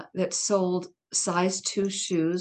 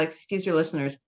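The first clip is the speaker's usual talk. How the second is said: ordinary talk, nothing marked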